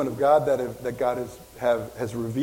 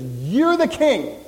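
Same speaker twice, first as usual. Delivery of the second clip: loud, slow, higher-pitched royal decree; God's proclamation; 4 semitones higher, 5 dB louder, much slower than usual. Acted. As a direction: proclaim it as God crowning someone. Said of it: "and he says".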